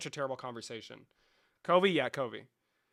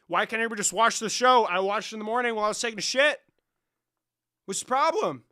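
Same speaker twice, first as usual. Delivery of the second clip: loud, high, furious spoiler rant; loud outraged tirade, higher pitched; angry rant; 6 semitones higher, 9 dB louder than usual. Acted. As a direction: yell it at the spoiler haters. Ranting angrily about spoilers.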